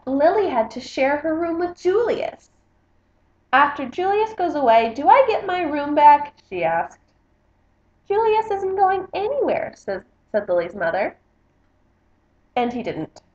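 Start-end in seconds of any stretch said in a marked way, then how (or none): 3.5-6.3 lily's voice. Acted. Lily asking parents. 8.1-9.7 mother's voice. Acted. Mother answers Lily.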